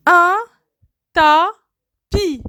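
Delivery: "Un" high, then "tapis" apart with a falling end; syllabified. Shown as a demonstration. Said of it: "word split into syllables". ("Un tapis" détaché, syllabe par syllabe)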